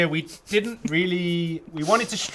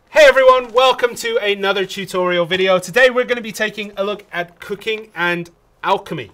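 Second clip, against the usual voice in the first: bright-up, higher presenter delivery; upbeat host opening a tutorial; tutorial video intro; 5 semitones higher, 5 dB louder than usual. Real, not acted. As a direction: launch loud into a video welcome, then settle into explaining.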